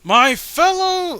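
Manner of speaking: high, slow booming villain address; Paul Bear voice. Acted. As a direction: proclaim like a cartoon villain. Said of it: "Paul Bear voice".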